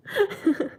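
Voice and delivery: quick laugh up high, falling away; a real high giggle after crying; high embarrassed laugh. Real, not acted.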